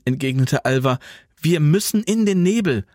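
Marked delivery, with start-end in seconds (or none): 1.4-2.9 high, emphatic urging; pitched-up, determined plea; urgent insistence. A narrator's reading. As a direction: press them to take the other route. Insisting on escape under attack.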